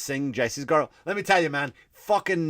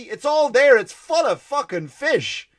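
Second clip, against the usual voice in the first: ordinary talk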